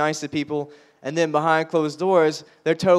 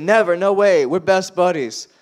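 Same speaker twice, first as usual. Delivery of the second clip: higher, louder, indignant never; loud refusal; 4 semitones higher, 4 dB louder than usual. Retold or imitated. Tattooed best friend refusing the military, loud indignant protest. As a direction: refuse it outright, loud and high.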